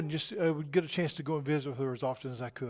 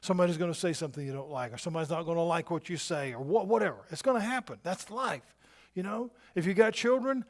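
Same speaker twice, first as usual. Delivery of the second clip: ordinary talk, nothing marked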